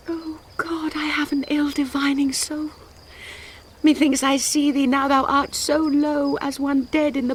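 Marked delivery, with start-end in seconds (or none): 0.1-2.7 hushed, dread-filled whisper; fearful whisper. Acted. Juliet whispering her fear to departing Romeo. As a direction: whisper the dark foreboding.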